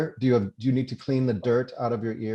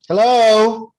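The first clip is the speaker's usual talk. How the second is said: calling out; 11 semitones higher, 13 dB louder than usual. Acted. Calling out at the door.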